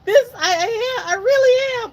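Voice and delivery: high, tearful and strained, voice breaking; tearfully insisting she is trying her best; crying. Retold or imitated. She is struggling to kick in the water, high crying protest. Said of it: "In my mind, I'm like".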